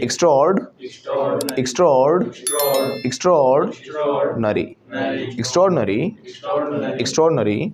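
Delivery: "extraordinary" as ik-STRORD-nuh-ree; corrected pronunciation. Shown as a demonstration. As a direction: drill "extraordinary" with stress on STRORD. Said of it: "It's extraordinary".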